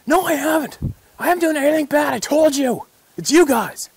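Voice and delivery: imitating a defensive hunter, high and loud; indignant denial. Hunter denying he did anything bad, mock indignant shout. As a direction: protest your innocence too hard.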